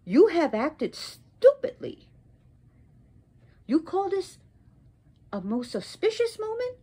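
high, incredulous indignation; emphatic rebuke climbing into disbelief; angry scolding. Acted. Goddess mocks her husband's auspicious moment, angry scolding. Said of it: "And angry".

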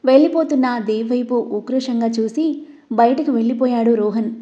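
plain reading voice

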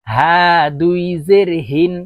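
হা and হিন with a light h from the bottom of the throat; small ha from throat's start. Shown as a demonstration. Teacher modelling small ha, light long হা from the throat's start. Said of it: "ছোট হা হলকের শুরু থেকে".

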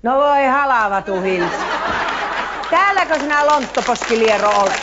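hammed-up dialect shout; shouting local. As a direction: bellow it like a startled Savo farmer. Shout heard at his ear.

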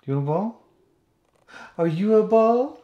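high, loud sing-song pet voice; baby talk to dog. Acted. Talking to the dog in high baby talk. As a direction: coo at the dog.

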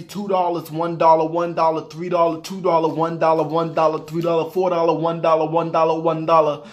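monotone run of prices, barely pausing; reciting a list. Acted. Shopper reading receipt to viewers, monotone reeling-off. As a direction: reel off the items one after another.

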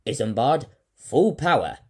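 loud urgent order over radio. Acted. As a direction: call full power to the mate. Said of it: "he said with a sense of urgency".

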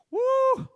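a very high, loud whoop of joy; ecstatic woo. Retold or imitated. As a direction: let out a piercing, happy woo. Recalling the Spirit's power.

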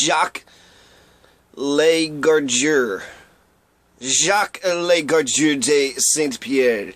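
Jacques Legardeur de Saint-Pierre drawn out slowly with a French lilt; French pronunciation. Shown as a demonstration. Relishing the commandant's full French name, slow. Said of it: "But I like that".

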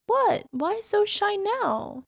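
high teasing surprise, slow; playful surprise. Acted. Mrs. Claus mock-surprised at her shy partner. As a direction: pretend to be amazed at his shyness.

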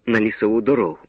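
plain reading voice

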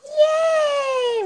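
put-on high, loud squeal of a little girl; little girl cheer. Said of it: "how the little girls go".

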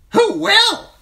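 very high, loud bird squawk of the words; a talking raven parroting its master; raven echoing. Acted. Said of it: "chimed the talkative raven".

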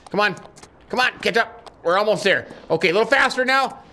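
high, excited push for speed; urgent urging. Pretend. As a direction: spur the horse on. Urging horse to catch the train.

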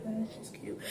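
whispered confession. Real, not acted. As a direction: breathe it secretly to the camera. Confiding about a crush.

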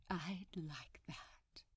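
slow, hushed, fond reply; slow smiling whisper to Crowley. Acted. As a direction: breathe it with a smile. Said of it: "said with a smile".

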